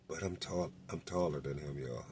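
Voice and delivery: imitating a low, very soft undertone. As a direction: say it under your breath so he won't hear. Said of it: "so he lowered his voice".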